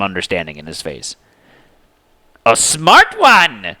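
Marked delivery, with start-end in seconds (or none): 2.4-3.8 high, slow, loud taunting yell. A narrator's reading. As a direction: yell back mockingly. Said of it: "the girl on top of the worm yelled back".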